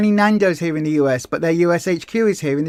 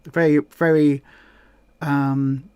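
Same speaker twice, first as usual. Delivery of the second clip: ordinary talk, nothing marked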